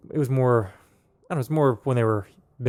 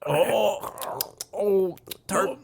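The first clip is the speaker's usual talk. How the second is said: mock pained; 6 semitones higher, 5 dB quieter, much slower than usual. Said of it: "you're just like".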